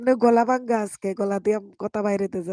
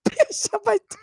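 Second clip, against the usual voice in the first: squealing, much higher and louder, cracked laughter; hoarse laughing fit; 14 semitones higher, 5 dB louder than usual. Real, not acted. Streamer cracking up at a viewer's channel name, squealing with laughter. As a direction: laugh so hard the broken voice squeaks. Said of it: "গলা ভাঙ্গে গেছে".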